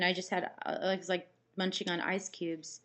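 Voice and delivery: usual speaking voice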